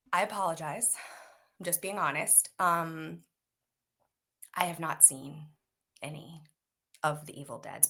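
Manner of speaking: low, soft, halting admission; acting out an awkward apology; sheepish confession. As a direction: confess quietly with long pauses. Sheepish confession retold to co-star.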